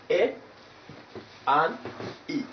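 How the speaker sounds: "A" and "E" as two short, pure sounds, pauses between; separate vowels. Shown as a demonstration. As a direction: isolate "A", pause, then "E". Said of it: "you pronounce the first vowel".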